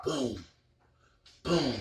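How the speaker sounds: hushed imitation of a distant pulse; very soft booms. Describing the swaying's return in meditation, hushed booms. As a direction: drop each boom right down, spaced apart.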